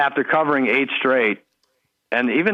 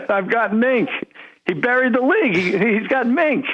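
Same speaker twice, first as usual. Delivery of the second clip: Mike Francesa impression; 11 semitones higher than usual. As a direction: crow about it like Francesa.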